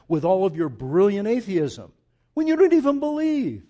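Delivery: rising preacher's challenge, ending high and loud; mounting pulpit indignation; impassioned preaching. Real, not acted. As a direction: fire off indignant questions, building to a shout.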